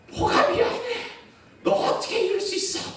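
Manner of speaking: very high, strained mock-furious squeal; imitated angry child. Brother refusing his sister's apology, squealing mock anger. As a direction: act out a boy rejecting an apology at full pitch. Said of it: "막 화를 냈는데".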